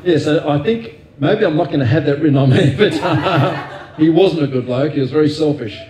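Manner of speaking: laughing as he imagines a mock epitaph. Real, not acted. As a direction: joke about your own epitaph through a laugh. Jokes about his own epitaph.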